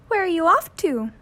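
high, light bird voice; a bright little bird asking; chirpy little wren. A narrator's reading. Jenny Wren asks Willie where he's going, high and chirpy. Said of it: "she asked".

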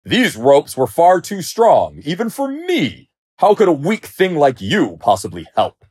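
loud, high, indignant outburst; angry lion roar. A narrator's reading. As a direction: rant at the weak little mouse. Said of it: "the lion roared angrily".

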